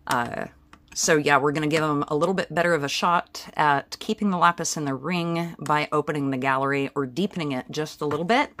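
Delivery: ordinary delivery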